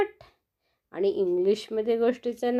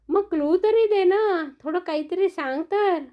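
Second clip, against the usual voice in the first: pleading for a clue; 7 semitones higher than usual. Acted. Friends begging for a clue.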